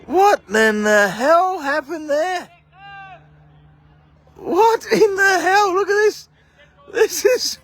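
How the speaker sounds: can't believe the blunder he just saw; stunned disbelief. Real, not acted. Commentator watching fielders both leave the catch, stunned outcry.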